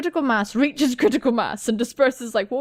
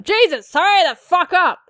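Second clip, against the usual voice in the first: loud, raised impatient shout; impatient yell; 6 semitones higher, 6 dB louder than usual. Acted. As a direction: yell at someone to get moving. Impatient riders yelling in game text.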